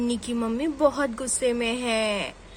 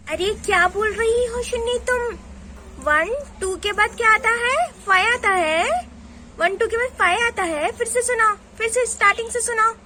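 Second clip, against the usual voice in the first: high, loud indignant scolding; angry mother; 8 semitones higher, 5 dB louder than usual. Acted. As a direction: scold the child's mistake loudly. Mother scolds wrong counting. (गुस्से में ऊँची आवाज़ से डाँटना)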